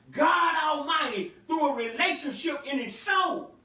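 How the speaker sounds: loud, fervent preaching. Acted. Pastor testifies to the church about spiritual freedom, fervent preaching. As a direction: testify with force and fire.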